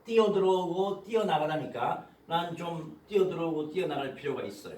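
ordinary talk, nothing marked

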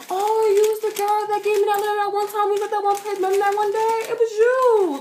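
high, gushing impression of a boy; acted excited recognition. Voices boy recognizing the letter writer.

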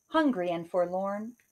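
ordinary delivery

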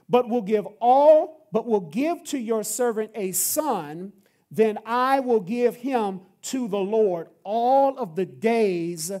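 loud, slow, solemn scripture reading; preached scripture. Acted. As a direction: proclaim the prayer slowly to the congregation. Preacher reads scripture aloud.

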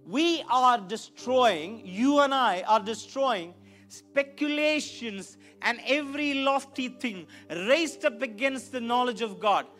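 ordinary talk

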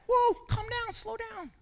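a startled, high-pitched mock soothing; mock whoa. Preacher playfully settling a frantic listener, high then fading.

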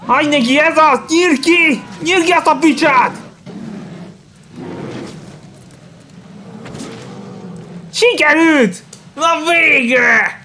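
high-pitched thrilled yells at the screen; egging the hunter on, pitch way up; excited cheering. Real, not acted. Gamer yelling at the monster fight, excited high cheering.

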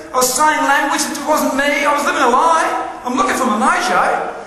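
high-pitched, worked-up lament; exasperated. Acted. Speaker tells the audience how he lost his mojo, exasperated. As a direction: play up the loss in a high, insistent voice.

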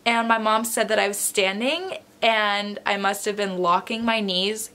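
usual speaking voice

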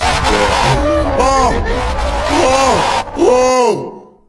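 high, loud cries of fright; startled scream. Real, not acted. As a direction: yelp from a sudden scare. Jump scare in horror game.

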